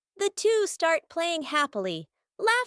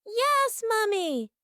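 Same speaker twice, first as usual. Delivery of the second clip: lightly acted cheerful child's reply, higher; 5 semitones higher, 3 dB quieter, slower than usual. A narrator's reading. Kids reply to their mother as she leaves, mildly cheerful. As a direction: answer with mild cheer, pitched up then falling. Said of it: "Both of them reply cheerfully".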